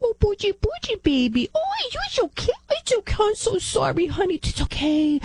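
put-on very high-pitched, gushing baby talk; mock coddling mom. Mom babying her whining little boy, mock coddling. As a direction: coo over a child in a hammed-up falsetto.

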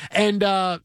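normal talking voice

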